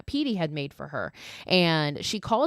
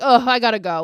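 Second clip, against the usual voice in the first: loud, higher mock groan into an excuse; exasperated brush-off; 5 semitones higher, 9 dB louder than usual. Mocking Adam's rude exit from May, exasperated brush-off. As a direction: imitate a put-upon excuse, loudly.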